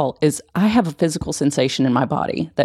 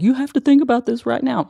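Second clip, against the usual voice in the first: pitched higher, a pushy inner voice demanding attention; nagging brain voice; 9 semitones higher than usual. Retold or imitated. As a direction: play the insistent inner voice, lightly. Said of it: "and that's what we're like".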